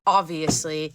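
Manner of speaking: ordinary delivery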